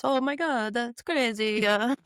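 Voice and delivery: mock Italian accent with a lilting "Oh my God". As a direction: imitate an excited Italian, with "crazy-uh" at the end. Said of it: "in his Italian voice".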